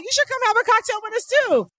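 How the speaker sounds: inviting the ticket agent for a drink, high and playful; high playful invite. Retold or imitated. Retold flirty invite, high.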